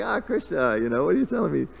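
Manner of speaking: high-pitched, put-on exasperation; mock protest. Sick man answers the teasing god, in high mock protest. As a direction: lift it high, as if caught out.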